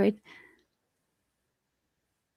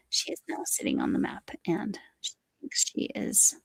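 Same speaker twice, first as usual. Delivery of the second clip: hushed whisper so the cat stays put; 6 dB quieter than usual. Real, not acted. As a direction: whisper it softly beside the sleeping cat. Cat sitting on the map.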